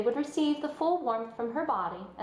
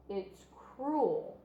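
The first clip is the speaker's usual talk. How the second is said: stubborn; 3 dB quieter than usual. A narrator's reading. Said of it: "not intend to be argued out".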